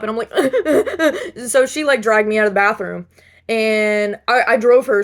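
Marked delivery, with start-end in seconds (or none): 0.4-1.4 high laugh breaking out; short laugh. Real, not acted. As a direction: laugh at herself. Laughing while retelling the story.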